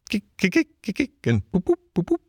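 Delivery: high-pitched stifled snorts and pops; strange suppressed noises. A narrator's reading. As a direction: squeak out little bottled-up bursts. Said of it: "making strange suppressed noises inside him".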